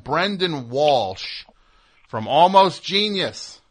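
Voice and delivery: slow, high, grand announcer; drawn-out radio host introduction; announcer intro. Acted.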